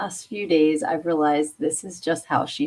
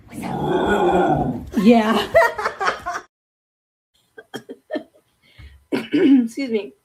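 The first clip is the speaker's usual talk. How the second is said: laughing, louder and higher; can't stop laughing; real laughter; 4 semitones higher, 4 dB louder, much slower than usual. Real, not acted. Still laughing at the puppy's metal scream.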